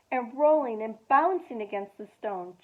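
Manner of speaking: ordinary delivery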